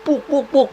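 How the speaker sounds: high, quick repeated "puk"; rhythmic mock impact sounds; imitated stabbing thuds. Meniru bunyi tusukan berulang. (tiruan bunyi tusukan berulang, nada tinggi)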